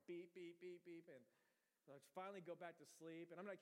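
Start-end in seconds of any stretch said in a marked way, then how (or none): 0.1-1.0 quick string of even beeps; imitated beeping. Wired sleeper echoing the alarm in his head, rapid beeps. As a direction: echo the alarm in rapid pulses. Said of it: "'cause I'm like".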